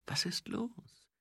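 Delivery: slow, soft whisper; quiet question. A narrator's reading. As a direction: ask discreetly under your breath. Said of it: "fragte Mumm leise". (leise geflüsterte Frage)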